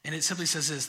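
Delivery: ordinary delivery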